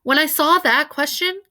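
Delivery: ordinary delivery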